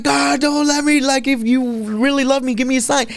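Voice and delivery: high-pitched, urgent plea to God; pleading prayer. Retold or imitated. Desperate prayer retold, voice high.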